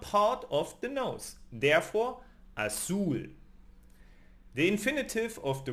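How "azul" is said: "azul" with the weight on the final -zul; stress on last syllable. Shown as a demonstration. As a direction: move the energy to the end of "azul". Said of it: "energy moves to the very end".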